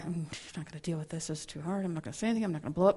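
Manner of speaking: low, hushed grumble under the breath; bottled-up mutter. Retold or imitated. A speaker playing someone who refuses to blow up, hushed mutter. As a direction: swallow the anger and mutter it.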